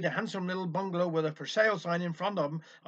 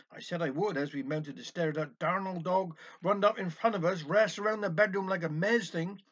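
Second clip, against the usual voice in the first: plain reading voice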